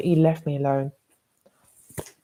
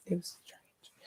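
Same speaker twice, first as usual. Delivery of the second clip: half-whispered, much softer aside; 20 dB quieter, slower than usual. Real, not acted. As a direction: drop to a whisper as if sharing a secret.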